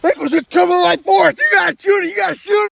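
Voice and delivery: high, loud panicked yells; urgent shouting. Acted. Guide shouting at Eccles to shoot the charging dinosaur. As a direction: yell at him to fire now.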